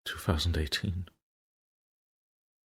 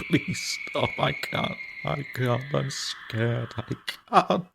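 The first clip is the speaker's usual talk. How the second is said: much higher, panicked and breaking; terrified high plea; 8 semitones higher than usual. Acted. Haunted man cornered by the screaming ghost, panicked high pleading. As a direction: beg like a cornered child.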